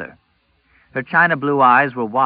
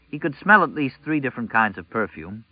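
ordinary delivery, nothing marked

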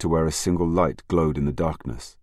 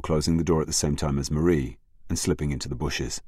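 ordinary delivery, nothing marked

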